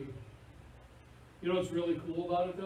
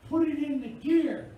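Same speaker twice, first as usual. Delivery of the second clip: helpful bystander; 8 semitones higher, 8 dB louder, slower than usual. Retold or imitated. Someone coaching the clueless driver.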